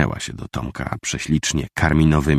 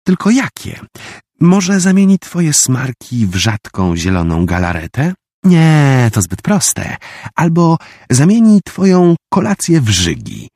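put-on gleeful scheming girl; 10 semitones higher, 5 dB louder than usual. Girl gleefully plans curses.

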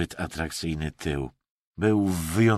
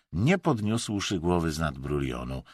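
usual speaking voice

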